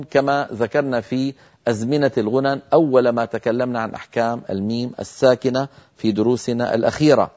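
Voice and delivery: ordinary delivery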